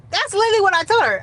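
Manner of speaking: high, loud and fast; excited emphasis. Real, not acted. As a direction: blurt it out, worked up.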